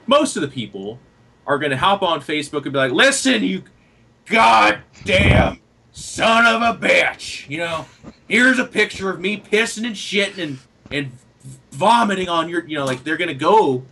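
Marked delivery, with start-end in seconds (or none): 2.9-12.6 much higher, louder mock rant, slow on the insult; hammed-up angry fan. A fan lashing out at a player who left, hammed-up yelling. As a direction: overact a furious fan yelling a hateful message. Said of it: "as ham as they can".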